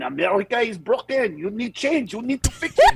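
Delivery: imitating a French guy lecturing; mock French accent scolding America. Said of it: "some French guy going".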